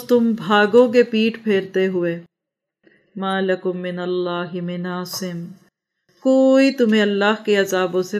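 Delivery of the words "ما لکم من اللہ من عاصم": soft, slow Arabic recitation; hushed, drawn-out scripture reading; Quran recitation. Real, not acted.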